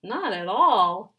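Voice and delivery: loud, slow insistent shout; loud, drawn-out protest. Acted. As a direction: slam the words down loudly. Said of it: "shouted Lunchbox Louie".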